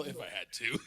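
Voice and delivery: soft, laughing mock echo; underhanded mock. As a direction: tease him with his own words. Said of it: "That underhanded little".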